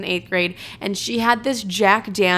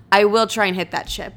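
ordinary delivery

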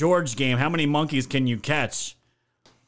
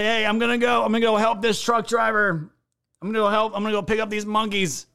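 lightly voiced airhead, a bit higher; mock naive relative; 6 semitones higher than usual. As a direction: play the clueless helper, lightly. Mocking a family member's quote.

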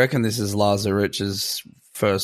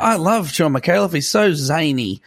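higher-pitched mock enthusiasm; gushing fan impression; 6 semitones higher than usual. Mimicking everyone who found the comedian on TV, mock gushing. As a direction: lightly parody a gushing fan. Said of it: "everyone's like".

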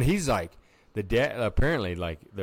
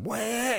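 imitated engine whoosh. Car suddenly rips around.

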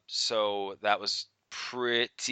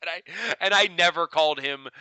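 laughing; 5 semitones higher, 4 dB louder than usual. Real, not acted. Laughing through the story.

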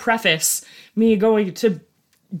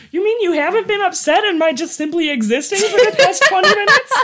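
incredulous; 11 semitones higher, 5 dB louder than usual. Retold or imitated. Husband's incredulous reply voiced.

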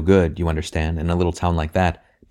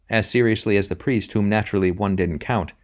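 ordinary delivery, nothing marked

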